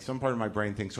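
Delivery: usual speaking voice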